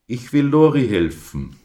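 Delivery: high, loud little lamb voice; determined little lamb. A narrator's reading. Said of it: "antwortete er".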